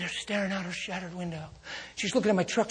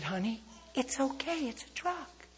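gentle reassurance; 8 semitones higher, 6 dB quieter than usual. Retold or imitated. Reassuring the lady.

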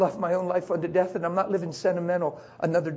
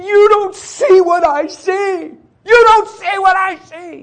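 slow, high-pitched screamed wail; loud, drawn-out shriek; freaking out; 16 dB louder, much slower than usual. Retold or imitated. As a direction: imitate a hysterical boy screaming at his dad. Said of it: "He's, like, freaking out".

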